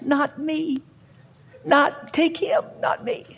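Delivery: small, high, soft pleading voice; tiny frightened branch voice. Pretend. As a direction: beg not to be cut, quietly. Branch voiced pleading before pruning.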